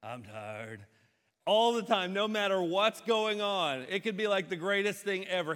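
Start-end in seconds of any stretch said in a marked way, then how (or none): 0.0-0.8 low, hushed, worn-out mimicry; Nick. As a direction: sigh it low and quiet, mock exhausted. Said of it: "Everybody go".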